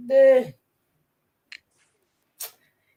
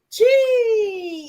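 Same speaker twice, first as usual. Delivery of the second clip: high shout; 13 semitones higher, 6 dB louder than usual. Real, not acted. High cry after singing.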